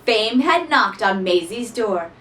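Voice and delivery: ordinary delivery, nothing marked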